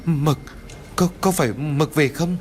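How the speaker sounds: stammering. A narrator's reading. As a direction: stutter the dog's name in fear. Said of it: "Ông Bưởi liền lắp bắp". (lắp bắp sợ hãi)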